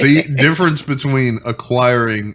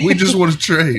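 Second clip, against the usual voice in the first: higher-pitched imitation British accent; playful go at a British accent; mock British accent; 6 semitones higher than usual. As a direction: tease with a put-on British voice. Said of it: "my terrible British accent".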